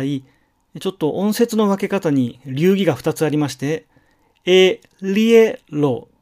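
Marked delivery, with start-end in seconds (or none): 4.4-6.1 エリエロ said very slowly in three clear syllables. Shown as a demonstration. Teacher dividing エリエロ into syllables. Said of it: "という三音節にして".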